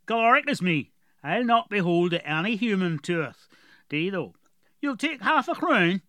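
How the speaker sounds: put-on gruff dwarf with a heavy rural accent; strong dwarf accent. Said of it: "Galoric had a very strong accent".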